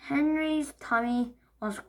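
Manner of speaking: usual speaking voice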